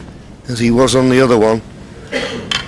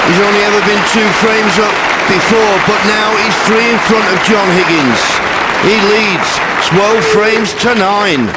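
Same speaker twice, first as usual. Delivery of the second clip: raised, thrilled call of the lead; excited commentary; 9 semitones higher than usual. Real, not acted. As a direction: lift the voice with delight. Century break, excited commentary.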